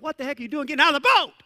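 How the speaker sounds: joking Jesus scolding Peter, hammed-up fast yell; mock exasperated scolding. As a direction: playfully chew him out.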